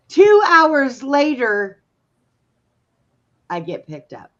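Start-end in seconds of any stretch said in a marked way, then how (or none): 0.1-1.8 laughing. Real, not acted. Laughing at the story.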